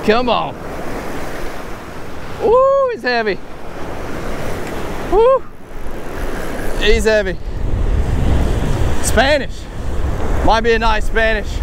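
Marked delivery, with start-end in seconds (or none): none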